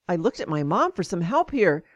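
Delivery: usual speaking voice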